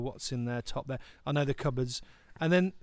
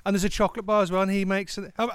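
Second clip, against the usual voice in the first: usual speaking voice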